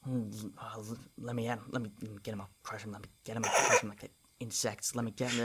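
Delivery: mumbling under the breath; very soft, repetitive muttered threats, words running together; Gregor. Acted. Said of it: "Gregor is kinda mumbling under his breath".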